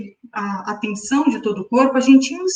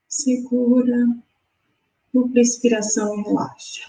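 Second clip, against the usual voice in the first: guided meditation. Acted. Guides breathing exercise.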